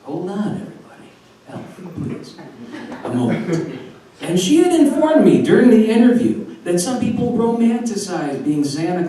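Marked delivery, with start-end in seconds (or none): 0.0-3.8 barely voiced, weak and hushed; acted faint plea. Overheated mall Santa calling the head elf, faintly. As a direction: ask for help with hardly any voice.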